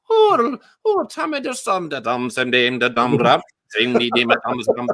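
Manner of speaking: half-joking Caribbean accent that drifts into [name]-style mumbling. Shown as a demonstration. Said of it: "sounding like [name]".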